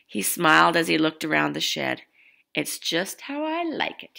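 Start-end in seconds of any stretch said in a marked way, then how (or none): 2.5-4.2 higher, softer proud satisfaction; proudly. A narrator's reading. Grandpa admiring his crammed shed, soft proud delight. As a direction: savour it, pleased with yourself. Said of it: "he said proudly".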